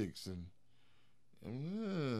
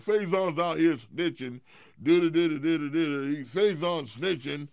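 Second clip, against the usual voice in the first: mocking whiny voice; 5 semitones higher, 7 dB louder than usual. Mocking Cat's accusation.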